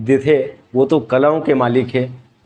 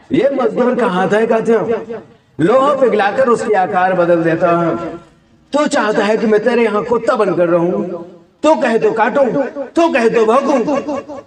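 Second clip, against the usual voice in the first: high, strained heroic outburst; defiant film hero; 7 semitones higher than usual. Acted. Worker refusing to be a villain's dog, defiant hero voice. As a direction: throw it back high and defiant, stretching the challenge. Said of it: "ये दोनों को mix series भैया।".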